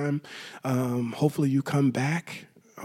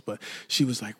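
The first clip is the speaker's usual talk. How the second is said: normal talking voice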